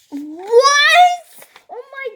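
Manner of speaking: high, loud startled cry; a sudden shocked squeal of disbelief; shocked shriek. Real, not acted. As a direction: blurt it out in shock. Sudden shock at page count.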